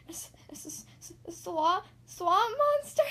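hissing stutter bursting into high, loud question; stutter, then squeal it in fright; terrified stammer. A narrator's reading. Goose freezes at the daisy's news, terrified stammer. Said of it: "Goose froze".

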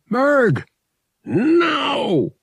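high, loud command yell; yelling into the intercom. A narrator's reading. Han ordering Murg to shoot over the intercom, loud yell. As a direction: bark the order to fire. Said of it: "he yelled into the intercom".

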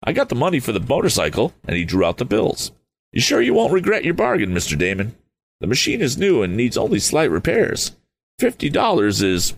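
Tom, fired up and youthful; eager young Tom. Acted.

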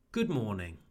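"good morning" with short vowel in good, falling tune. Shown as a demonstration. Teacher models the fix for learners, short oo in "good". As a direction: say "good morning" with a quick English "good". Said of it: "we have".

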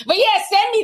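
laughing through the words. Real, not acted. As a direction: giggle while asking. Laughing at own joke.

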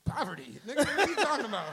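fast, loud, laughing scoff; laughing disbelief. Retold or imitated. Mocking the rich friend's claim, fast laughing scoff.

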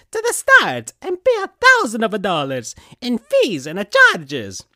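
high, loud Italian plumber voice; squeaky cartoon principal, big and bright; Mario voice. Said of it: "I chose Mario for the principal".

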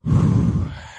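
long, audible exhale; deep sigh of realisation; heavy sigh. Real, not acted. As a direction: let out a big breath as it sinks in. Truth lands after a long silence.

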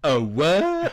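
mock-affronted, pitch climbing into a question; offended disbelief. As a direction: repeat it as an appalled question. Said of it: "keep saying that throughout the podcast".

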